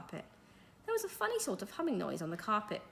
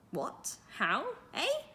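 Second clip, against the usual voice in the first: spluttering surprise; 6 semitones higher, much slower than usual. Acted. Teacher sees flying carpet man.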